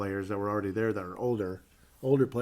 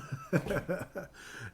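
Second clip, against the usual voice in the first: light laugh after the joke; 7 semitones higher, 5 dB quieter than usual. Real, not acted. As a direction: let a quick laugh out.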